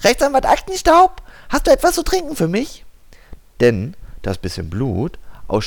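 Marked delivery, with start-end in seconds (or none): 0.0-2.9 high, thin, whispery little mosquito; tiny mosquito voice. Acted. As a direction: squeak it like a tiny insect. Mosquito asks lawyer for drink.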